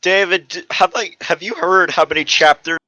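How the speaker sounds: ordinary delivery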